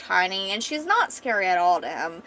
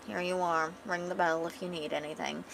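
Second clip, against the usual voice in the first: low, subdued and much softer; depressed manservant; 3 semitones lower, 12 dB quieter than usual. Acted. Showing guests to their room, depressed. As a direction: glum servant, voice sunk low and soft. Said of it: "little depressed manservant Gerald says".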